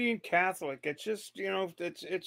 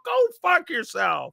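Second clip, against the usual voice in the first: high, drawn-out exasperated cry; exasperated outburst; 8 semitones higher, 4 dB louder than usual. Acted. Commentator answering the atheist-paradigm argument, exasperated outburst. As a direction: blow up at the debater, high and slow.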